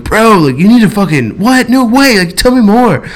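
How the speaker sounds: high, gushing fake disbelief; playing real stupid. Storyteller plays dumb for the armed dealer, high mock amazement. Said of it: "just playing real stupid".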